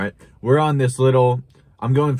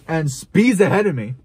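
normal talking voice

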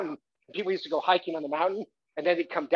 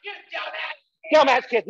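mock angry yell; 10 semitones higher, 4 dB louder than usual. Shown as a demonstration. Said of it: "rehearse with them".

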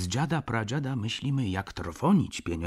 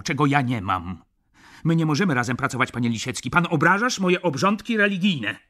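louder, faster, outraged; indignant shout; 7 semitones higher, 8 dB louder, faster than usual. Acted. Szlangbaum shouts at clerk Lisiecki, offended and loud. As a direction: shout back, offended to the core. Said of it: "zawołał".

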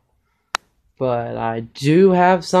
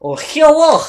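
a bright put-on clone hello, much higher; evil clone voice; 10 semitones higher, 4 dB louder, slower than usual. The boy shows his friend how the evil clones talk, in a much higher voice. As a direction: jump much higher and louder into a silly clone greeting. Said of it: "they talk like this".

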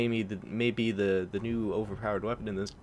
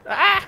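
high loud startled cry; startled yelp; 14 dB louder than usual. Real, not acted. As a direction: yelp at the sudden hit. Sudden hit in game.

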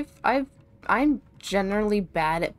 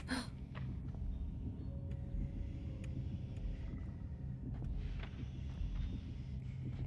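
hushed gasp; 3 semitones higher, 13 dB quieter than usual. Real, not acted. Discovering sleeping babies.

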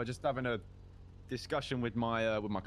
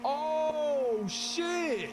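surprised; 11 semitones higher than usual. Acted. Sudden recognition of old friend.

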